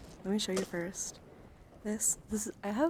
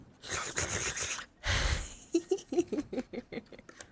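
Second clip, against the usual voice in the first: high, long giggle trailing downward; delighted giggle that runs on and falls away; giggling; 7 semitones higher than usual. Real, not acted. Streamer giggling over favourite cards, delighted giggle.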